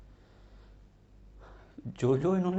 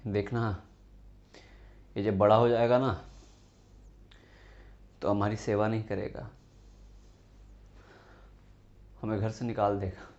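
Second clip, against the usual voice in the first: retold taunt; 7 semitones lower than usual. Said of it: "मुझे ताने मारते थे". (पिता के ताने की नकल)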